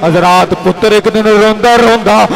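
high, loud impassioned sermon; full-force preaching. Acted. Preacher building to the climax of the story, full-force preaching.

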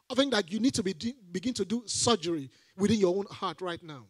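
ordinary talk, nothing marked

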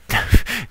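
sudden high loud laugh; burst of laughter. Real, not acted. Laughing at himself while decrypting the helix. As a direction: crack up at your own silliness. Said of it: "c'est mon cerveau qui part en live".

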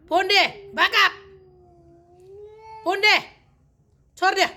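loud, high, short calls; sharp pet command. Real, not acted. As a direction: order the dog off, loud and high. Calling angry dog off. (malakas at matinis na pagsaway sa aso)

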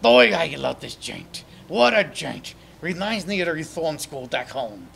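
loud slow gruff tough guy; tough guy puppet. Pretend. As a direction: play a street-tough ex-con.

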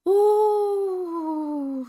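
high, loud, long-drawn "oh"; alarmed realisation. A narrator's reading. Hooter suddenly remembers trapped Roo, loud alarmed cry. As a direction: let a startled oh ring out and fall.

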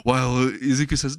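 plain reading voice

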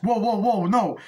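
loud, high imitated protest; panicked protest. Retelling driver at gunpoint to cop, panicked protest.

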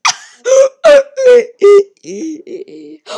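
loud, high whimpering laugh; startled laugh-cry. Real, not acted. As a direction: laugh shrieking, close to crying. Reaction after being startled.

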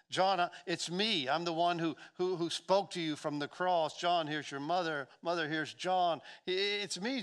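insistent, full-voiced plea to be recognized; impassioned preaching. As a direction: proclaim it urgently, easing at the end. Preacher voices Jesus addressing John.

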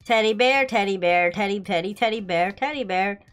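ordinary talk

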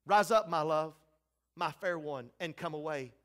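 low, tender summons, softening at the end; fatherly call. Acted. Father speaking to Jesus on the cross, low tender call. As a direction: call gently, letting the voice sink. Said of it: "These are the words of Father to Jesus".

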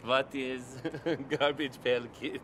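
put-on Persian accent, a laugh breaking in. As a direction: answer in a mock Persian accent, amused. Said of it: "have to say it in a Persian accent".

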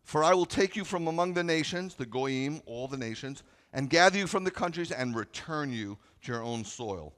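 loud, high preaching of the verse; full-force pulpit reading; preaching scripture. Acted. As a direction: proclaim it to the congregation.

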